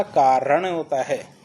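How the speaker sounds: कारण slowed with a firm retroflex ण; correct word said slowly. Shown as a demonstration. Teacher correcting the pupils' कारण. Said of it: "कारण नहीं होता".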